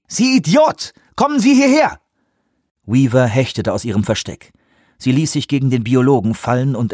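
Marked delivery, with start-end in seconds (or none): 0.1-2.0 high-pitched angry command; called out. A narrator's reading. Lee orders the fleeing biologist back, calling out angrily. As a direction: yell at him to get over here. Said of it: "rief Lee".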